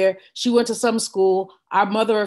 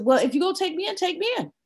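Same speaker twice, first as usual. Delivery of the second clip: high, softer defiant dare; 7 semitones higher, 4 dB quieter, faster than usual. Retold or imitated. Daughter daring the officer, high and soft. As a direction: dare him to do it. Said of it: "I said".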